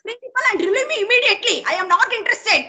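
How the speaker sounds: high, heated final demand; angry demand. Real, not acted. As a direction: insist angrily on leaving.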